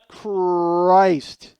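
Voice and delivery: loud exasperated groan of a word; fed up with the dead fire; exasperated outburst. Acted. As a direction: blurt it out in frustration.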